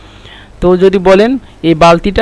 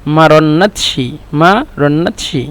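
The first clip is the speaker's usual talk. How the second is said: slow repetition; slower than usual. Shown as a demonstration. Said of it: "একসাথে হলো".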